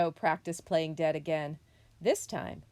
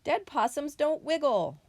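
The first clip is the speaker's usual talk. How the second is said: high, loud motherly correction; scolding mother possum; 9 semitones higher, 6 dB louder than usual. A narrator's reading. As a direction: lay down the rule, pitched up. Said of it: "Mother Possum scolded".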